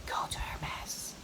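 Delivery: slow, soft whisper in her ear; a tempting inner voice whispering slowly. Pretend. A voice in her head whispers her toward the Hermes store. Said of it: "a voice whispered to my ear and said".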